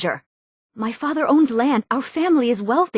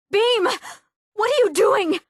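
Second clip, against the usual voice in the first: high, drawn-out cry of dismay; alarmed cry; 13 semitones higher, much slower than usual. Acted. Treasure hunter calls out to Beam over what he is doing, alarmed cry. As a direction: call out in shocked protest, pitch leaping up.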